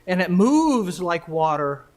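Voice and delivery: loud, measured delivery with long pauses; formal speech. Real, not acted. Formal talk after the poem.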